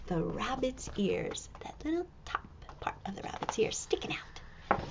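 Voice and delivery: soft secretive whisper. Acted. Reader to children, secretive whisper. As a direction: lean in and whisper it.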